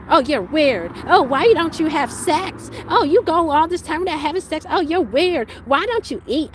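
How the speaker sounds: high, loud sneering mimicry; mock the man who called her weird; mocking his words. Mocking the man who called her weird, high and loud. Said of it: "You mocked his ways".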